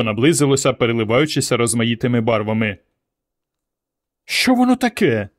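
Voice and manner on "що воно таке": put-on high, startled frightened girl; a child suddenly scared by a light in the sky; scared squeak of a question. A narrator's reading. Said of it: "злякалася Варка".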